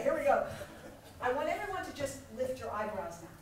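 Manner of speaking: usual speaking voice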